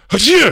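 sudden loud high fake sneeze; mock sneeze. Comic passing off a sneeze as George Washington, mock sneeze. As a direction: burst out an exaggerated achoo. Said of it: "dead-on George Washington impression".

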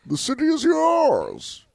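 high, drawn-out supervillain voice; Bane. Acted. Testing for a snitch, as Bane. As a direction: stretch it out in a high villain voice. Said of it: "They did the whole Bane thing".

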